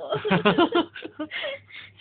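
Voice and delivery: high-pitched laughing fit; laughter. Real, not acted. Coaxing a child to kiss the baby, laughing. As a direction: laugh high in little bursts.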